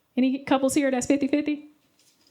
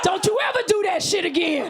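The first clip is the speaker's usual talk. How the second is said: raised-pitch angry warning; mock outrage; 7 semitones higher than usual. Man warning the partner after the act, mock furious. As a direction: scold hard, hammed up.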